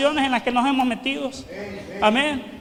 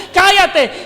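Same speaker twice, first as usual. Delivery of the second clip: loud, raised scolding command; rebuking; 4 semitones higher, 5 dB louder than usual. Acted. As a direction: order a shouting man to be silent. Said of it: "le reprendían y le decían". (reprensión en voz alta)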